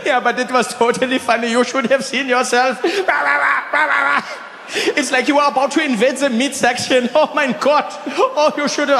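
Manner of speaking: high German-accented mock laughter; Rolf. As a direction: tease while cracking up, German accent. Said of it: "He's like".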